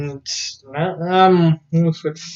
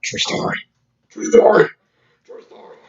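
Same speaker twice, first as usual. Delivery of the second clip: whispered true story. Retold or imitated. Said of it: "It's like".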